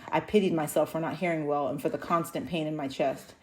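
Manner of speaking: usual speaking voice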